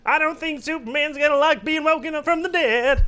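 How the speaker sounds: high, silly comic narration; hammed-up cartoon worrier; high cartoon superhero voice. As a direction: pitch it way up, half laughing. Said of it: "If you do any voices".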